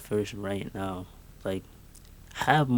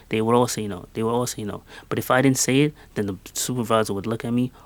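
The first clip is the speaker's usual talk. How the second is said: normal talking voice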